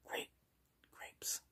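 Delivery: soft whispered word list for a hearing test. Shown as a demonstration. Doctor whispers fruit names to test the patient's hearing. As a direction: breathe each word with no voice. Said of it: "I'm gonna whisper to you".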